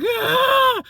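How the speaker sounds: high, loud cry of pain; pain scream. Retold or imitated. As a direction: scream out as the neck gives way. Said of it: "I was like".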